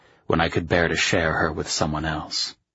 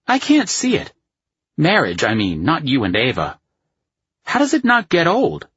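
high-pitched, louder voice for the doubting friend; sceptical male friend; 10 semitones higher, 5 dB louder than usual. A narrator's reading. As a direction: play the incredulous buddy, pitched well up. Voices the narrator's friend in story.

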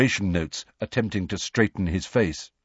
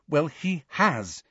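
slow, higher-pitched firm insistence; 7 semitones higher, 4 dB quieter, slower than usual. A narrator's reading. Hermione contradicts Ron about Hagrid, quietly firm. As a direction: state it deliberately, allowing no argument. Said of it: "said Hermione firmly".

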